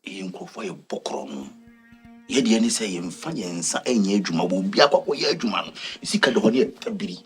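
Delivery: usual speaking voice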